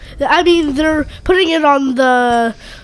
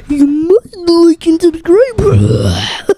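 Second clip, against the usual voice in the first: slow, hammed-up kid villain voice; a lightly voiced evil villain; 3 semitones higher, 3 dB louder, much slower than usual. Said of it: "I gotta do it in my evil voice".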